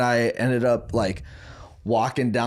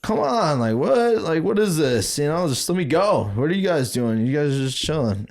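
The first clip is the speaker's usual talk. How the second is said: much higher whiny complaint; mock teen protest; 6 semitones higher than usual. Teen begging his parents to let him go out, high whiny protest. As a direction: whine like a grounded teen.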